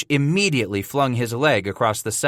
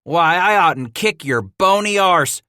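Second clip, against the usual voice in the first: blustering trapper; 7 semitones higher, 5 dB louder than usual. A narrator's reading. Hatcher's mock threat.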